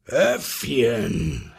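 lion's cry in a put-on voice; growly lion summons; lion calling out. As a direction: call across as a big lion. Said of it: "schrie da der Löwe".

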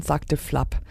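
usual speaking voice

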